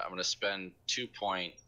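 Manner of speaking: ordinary delivery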